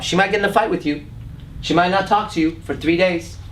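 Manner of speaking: normal talking voice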